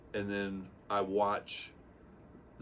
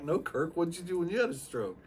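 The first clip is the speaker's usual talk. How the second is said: higher, put-on wide-eyed asking voice; mock curious; 10 semitones higher, 4 dB louder than usual. Humoring his friend Kirk with a high, mock innocent question. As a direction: play the eager student, a bit silly. Playing along with Kirk's question.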